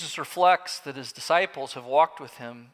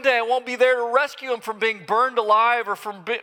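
raised, pleading voice of a frantic father; desperate father; 8 semitones higher, 7 dB louder than usual. Acted. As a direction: plead as a father terrified for his son. Distraught father pleads with Jesus.

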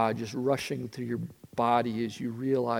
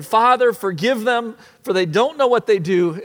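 higher, louder, pleading prayer; Jesus praying, fervent; 9 semitones higher, 11 dB louder than usual. Acted. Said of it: "you hear this one".

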